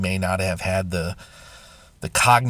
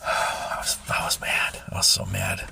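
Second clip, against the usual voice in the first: "I was so mad" in a low breathy whisper; whispered confession; 14 semitones lower, 6 dB quieter than usual. Real, not acted. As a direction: whisper the anger, held back. Confessing anger, voice drops to whisper.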